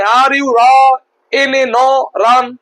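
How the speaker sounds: রা and ন said apart, then রান; broken into pieces. Shown as a demonstration. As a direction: chop the word into separate bits as a wrong example. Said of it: "এরকম হয়? হয় না।". (ভুলভাবে ভেঙে ভেঙে)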